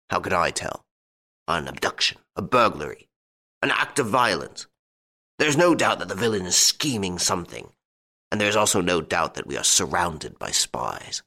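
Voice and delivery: put-on old Duke brooding on his suspicions; fearful old Duke. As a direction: voice an old nobleman obsessed with plots.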